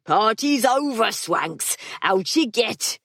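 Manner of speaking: gruff, slow call to clear the room; John breaking up the party; mild shout. Acted. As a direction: bark it at the ceiling.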